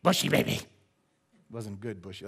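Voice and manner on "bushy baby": a hint of a scornful old lady; grandma's sneer. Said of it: "but she said".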